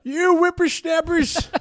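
put-on high, slow grumpy old man; old prospector. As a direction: scold like a cranky geezer. Old-timer voice continues.

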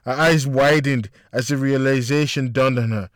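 ordinary delivery

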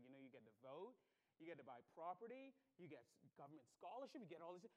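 low, quick list with rising ends. Retold or imitated. Immigration officer lists an applicant's new privileges, listing off. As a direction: reel off items softly, each lifting at the end. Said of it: "she started listing them out".

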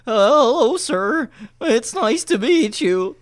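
put-on a scared twelve-year-old choking out polite words; terrified kid voice. Boy meeting girlfriend's hunter father, terrified squeak. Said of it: "It sounds like that TikTok filter".

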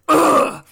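loud, high effortful grunt; exaggerated grunt of exertion; mock grunt. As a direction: grunt hard, imitating an action hero. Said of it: "and he just grunts".

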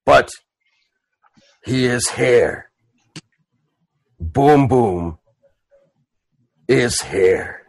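very slow half-orc with long pauses; Boom Boom. Acted. Half-orc announces himself at the funeral, ponderous. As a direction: plod through it word group by word group. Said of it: "And he goes".

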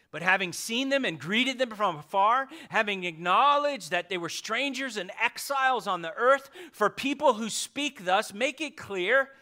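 fervent pulpit reading; impassioned scripture reading. Real, not acted. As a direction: read it out like a herald. Reads scripture loud and high.

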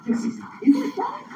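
high-pitched, half-laughing question; comically unsure, voice lifted high; wondering doubt. Retold or imitated. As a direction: squeak it out in mock uncertainty. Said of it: "I wondered myself".

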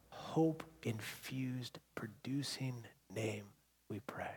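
soft, slowing, hushed prayer; closing prayer. Real, not acted. Pastor ending the prayer in Jesus' name, hushed. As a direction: let each phrase sink toward a whisper.

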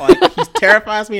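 gushing loud about the actor; excited. Real, not acted. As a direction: blurt it out with delight. Exaggerated fear, laughing.

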